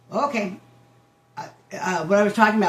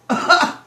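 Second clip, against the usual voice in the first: loud laugh; 12 semitones higher, 8 dB louder than usual. Real, not acted. Reacting to shocking lyric.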